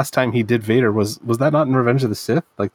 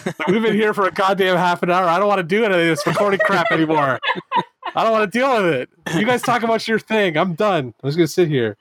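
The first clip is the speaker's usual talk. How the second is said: impatient; 8 semitones higher than usual. Real, not acted. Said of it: "Now I'm, like, impatient about this".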